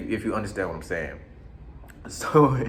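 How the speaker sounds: ordinary talk, nothing marked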